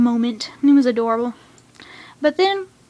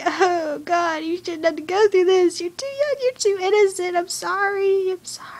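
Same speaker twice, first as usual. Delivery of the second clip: retold tearful pleading; 6 semitones higher than usual. Fan retelling crying for the young hero, tearfully sorry. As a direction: plead high, fading to a near-whisper at the end. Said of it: "I was starting to cry for Baal".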